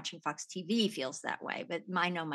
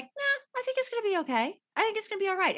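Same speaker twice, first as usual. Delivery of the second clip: breezy, high, easygoing reassurance; light and unbothered, like nothing could go wrong; carefree inner voice; 13 semitones higher, faster than usual. Retold or imitated.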